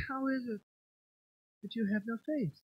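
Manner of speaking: soft, serene and unbothered; mock pious calm. Pastor mocking a serene Jesus in the boat, soft and calm. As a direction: say it gently as if nothing is wrong. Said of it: "You think he just said".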